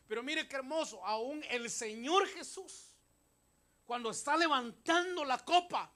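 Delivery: shouted, high-pitched sermon delivery; full-force preaching. Real, not acted. Preacher explaining the Passover lamb to the congregation, full-force. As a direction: preach loudly, pitch pushed up, punching each phrase.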